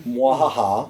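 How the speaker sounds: mock evil villain laugh; hammy spelled-out evil cackle; mad scientist. Joke about triggering listeners.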